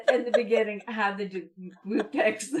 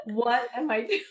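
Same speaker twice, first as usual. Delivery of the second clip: talking through giggles; helpless laughter between the words; laughing; 11 semitones higher than usual. Real, not acted. Mother laughing at her mishap.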